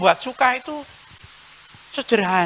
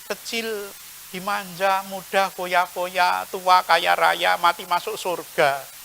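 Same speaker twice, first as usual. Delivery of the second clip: wistful wish; 5 semitones lower, 8 dB quieter, slower than usual. Retold or imitated. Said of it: "Jangan mengatakan".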